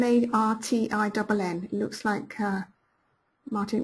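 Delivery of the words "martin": ordinary delivery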